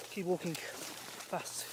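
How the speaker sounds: drawn-out soft words to himself; slow quiet self-talk. Real, not acted. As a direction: talk yourself through it slowly. Self-talk while sneaking.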